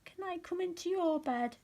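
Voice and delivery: squeaky little mouse asking; Little Mouse's voice. A narrator's reading. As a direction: pipe it up like a tiny pleading mouse. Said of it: "asked Little Mouse".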